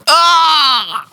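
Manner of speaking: sudden high cry of shock. Real, not acted. Host hearing husband is leaving, high shocked cry.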